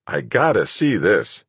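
slow, lightly voiced man, eager to look; Rodriguez, intrigued. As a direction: draw it out with curiosity. Rodriguez's character voice.

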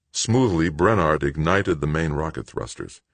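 ordinary delivery, nothing marked

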